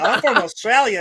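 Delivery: high, loud mock Australian reply; imitating Jesse. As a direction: pipe up as a hammed-up Aussie visitor. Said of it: "And he'd be like".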